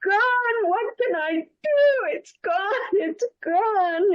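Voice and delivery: high, slow, wailing sobs; wailing and crying. Acted. Abdullah finding his gold gone, high tearful wail. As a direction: cry it out at the top of your range. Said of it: "and screaming".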